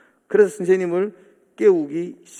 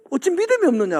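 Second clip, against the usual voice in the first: rebuke; 8 semitones higher than usual. Retold or imitated. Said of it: "도리어 역정을 내시고".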